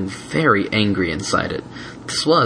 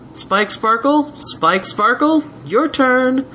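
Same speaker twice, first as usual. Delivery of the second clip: intercom; much slower than usual. A narrator's reading. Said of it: "a voice over a scratchy intercom".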